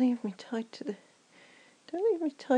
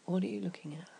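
whisper; 6 semitones lower, 7 dB quieter, faster than usual. Acted. Whispering during sex scene.

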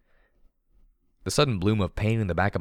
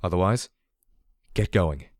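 firm. Acted. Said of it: "said firmly".

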